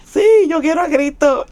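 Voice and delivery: eager yes. Retold or imitated. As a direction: blurt it out with eager joy. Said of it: "y yo". (sí entusiasmado, mucho más agudo)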